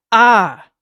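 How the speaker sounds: loud falling "ah" scream; sudden full-voiced cry; startling scream; shriek. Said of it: "He heard the shriek, the cry, the scream".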